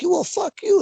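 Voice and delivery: high, loud fired-up snap back; a heated yell in a shoving match; defiant retort. Retold or imitated. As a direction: throw it back at him, furious. Acted shove-back quarrel in ring.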